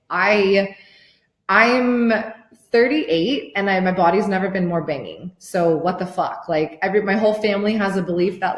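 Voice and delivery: normal talking voice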